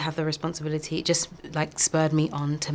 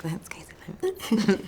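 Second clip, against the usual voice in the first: breathless, slow awed whisper; 3 semitones higher, much slower than usual. Retold or imitated. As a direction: whisper it starstruck. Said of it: "I was like".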